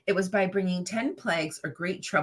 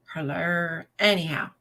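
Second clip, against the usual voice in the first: normal talking voice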